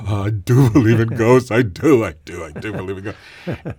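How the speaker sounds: loud, quavering Cowardly Lion voice; blustery, quavering lion imitation; Bert Lahr's Cowardly Lion. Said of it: "a sort of a cross between Bert Lahr in The Wizard of Oz".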